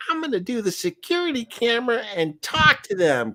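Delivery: high, bright wife's voice. Retold or imitated. Husband quoting wife's camera plan, high voice. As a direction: sell the plan cheerfully as the wife. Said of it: "she's like".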